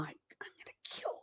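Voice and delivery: whispered exasperation at the cat; barely audible threat under the breath; hushed, voiceless grumble at a pet; muttered mock threat. Real, not acted. Exasperated at cat hitting the mouse.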